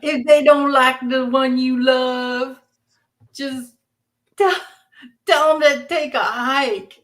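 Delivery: slow, playful Southern drawl; drawn-out Southern twang, hammed up; Southern accent. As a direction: stretch it out in a folksy Southern accent. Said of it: "feel my Southern accent coming out again".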